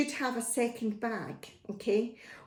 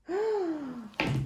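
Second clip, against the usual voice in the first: gasp; 7 semitones higher, 5 dB quieter than usual. Acted. Reading to children, shocked.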